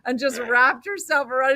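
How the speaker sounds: ordinary talk, nothing marked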